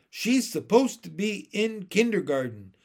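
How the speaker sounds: high, loud explanation; tattling sister. A narrator's reading. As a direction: report a younger child to the teacher. Sister tattling to yard teacher.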